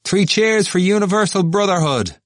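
high, loud rallying shout; cheering cry. Acted. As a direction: cry it out to the crowd.

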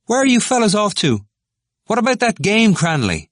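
high, loud hail; calling out. A narrator's reading. Dixon hailing the departing pair from the steps, calling out. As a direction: shout across a distance to friends. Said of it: "called out".

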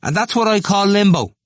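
high, loud jeer; scornful cry. A narrator's reading. Temple taunting Cranley about limbo, scornful cry. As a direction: cry it out with scorn, pitch right up. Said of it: "Temple cried out scornfully".

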